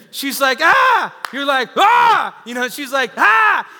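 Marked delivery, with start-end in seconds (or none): 0.6-1.2 high, loud scream; sudden comic shriek of pain; mock labor scream. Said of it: "If she starts screaming". 1.8-2.4 high, loud copycat scream; comic panicked husband's shriek; mock answering scream. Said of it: "you start screaming". 3.2-3.7 high, loud scream; sudden comic shriek of pain; mock labor scream. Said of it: "If she starts screaming".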